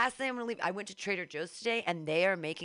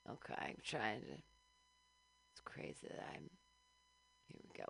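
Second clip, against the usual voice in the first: sleepy murmur; 4 semitones lower, 15 dB quieter than usual. Real, not acted. Sleepy mumbling.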